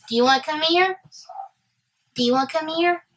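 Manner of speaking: high, sing-song invitation rising at the end; coaxing pet talk. Real, not acted. Coaxing an interrupter, likely a pet.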